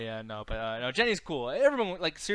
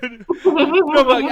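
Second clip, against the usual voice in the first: laughing; 8 semitones higher, 11 dB louder, much slower than usual. Real, not acted. Laughing hard.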